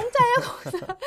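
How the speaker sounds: high, loud, laughing exclamation; giggly and thrilled; delighted about freebies. Acted. Teacher playing tourists who love freebies, high and laughing.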